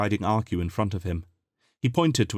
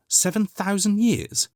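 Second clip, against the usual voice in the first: much higher, stunned question; shocked disbelief; 12 semitones higher than usual. A narrator's reading. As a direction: throw the number back in disbelief. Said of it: "exploded Chris".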